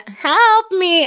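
high, drawn-out mock wail of help me; imitating a moaning patient down the hall; plaintive cry for help. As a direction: whimper it high like a helpless old patient. Patient calling out again.

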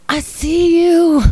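high, loud, slow singsong call; hammed-up eerie stoned cry; stoned weirdo. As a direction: stretch it out in a high, silly voice. Acting out stoned self, nose to nose.

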